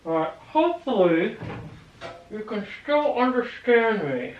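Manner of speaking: talking around a mouthful of nails. Real, not acted. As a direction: speak with nails held in lips. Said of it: "I'm going to put the nails in my mouth".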